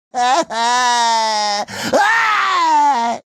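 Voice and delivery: long wavering cry; drawn-out cartoon scream. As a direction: let out two stretched yells. Wordless effort cries.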